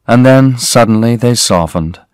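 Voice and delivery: ordinary delivery, nothing marked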